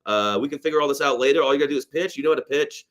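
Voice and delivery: ordinary talk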